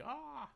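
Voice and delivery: sudden high "ah" of shock; mock shocked gasp. Streamer demonstrating the shocked face, mock shocked gasp. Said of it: "shocked, like".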